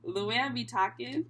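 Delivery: ordinary talk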